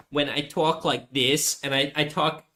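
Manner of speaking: a hint of a Jersey old man; Jersey accent. Shown as a demonstration. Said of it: "that voice I do".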